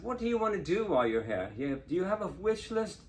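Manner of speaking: put-on British accent. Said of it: "with that wonderful British accent".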